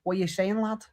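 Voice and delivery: high-pitched imitated Scouse; Scouse accent. Shown as a demonstration. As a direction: mimic a Scouser greeting a mate. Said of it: "And then with Scousers, like".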